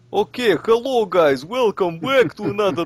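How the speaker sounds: high-pitched, hammed-up Russian accent. Shown as a demonstration. Let's Player greeting viewers in a Russian accent, hammed up. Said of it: "do a Russian accent to it".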